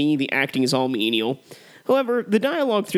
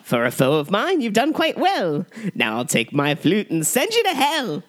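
slow, high, gloating villain rhyme; hammy stage actor; 4 semitones higher than usual. Acted. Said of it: "we'll quote this film back and forth".

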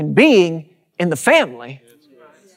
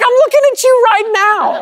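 high, loud astonished squeal, far above his own voice; amazed squeal; 3 dB louder than usual. Retold or imitated. Tech rep hearing the Copeland name, amazed high squeal. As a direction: squeal like a starstruck fan. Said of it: "He's like".

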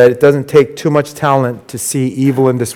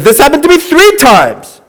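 much higher, louder exclaimed line; exasperated Peter; 13 semitones higher, 4 dB louder than usual. Retold or imitated. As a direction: exclaim it high, amazed. Peter's retold words.

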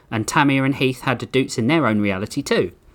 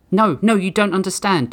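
higher, urgent frightened plea; fearful; 8 semitones higher than usual. Acted. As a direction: plead in a mild panic. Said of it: "Doot said with fear".

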